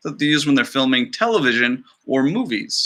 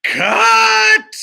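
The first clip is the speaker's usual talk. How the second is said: sharp, much higher, louder shout; director yelling cut; 7 dB louder than usual. Acted. The director stopping the documentary take, shouting. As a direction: bark cut like a fed-up film director.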